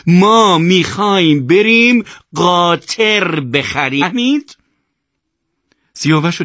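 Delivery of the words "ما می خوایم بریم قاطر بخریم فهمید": high, loud, stretched-out yell; exasperated shout. A narrator's reading. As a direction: shout it slowly as if nobody understands. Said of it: "یوسف سرش رو گرفت و فریاد زد". (فریاد کلافه و کشدار)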